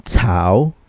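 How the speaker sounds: "cao" long, dipping low then rising; third tone. Shown as a demonstration. Teacher drilling learners in third tone, "cao" dipping and rising. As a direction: sink down on "cao" and climb back up. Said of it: "how would we read this third tone".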